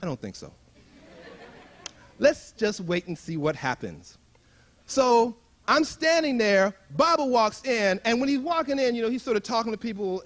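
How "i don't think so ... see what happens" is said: quiet reluctant refusal. Retold or imitated. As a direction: decline quietly from the wall. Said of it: "I'm like".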